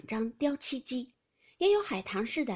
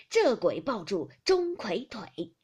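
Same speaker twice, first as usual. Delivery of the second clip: slow, louder, drawn-out line; verse recitation; 6 dB louder, slower than usual. A narrator's reading. Grandmother capping the round with a rhyme, slow emphatic recitation. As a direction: stretch each beat of the rhyme.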